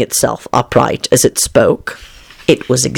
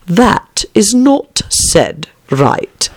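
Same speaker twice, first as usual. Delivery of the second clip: very slow, higher caterpillar verdict; disapproving caterpillar; 7 semitones higher, much slower than usual. A narrator's reading. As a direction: spell out the fault word by word, loftily. Caterpillar passes judgement.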